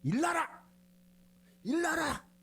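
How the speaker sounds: very high, forceful Gyeongsang command; Gyeongsang dialect. Shown as a demonstration. Preacher putting Jesus's command into Gyeongsang dialect, forceful. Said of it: "경상도 말로 하면 뭐라 그래?".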